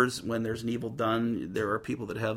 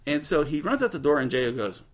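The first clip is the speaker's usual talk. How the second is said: ordinary delivery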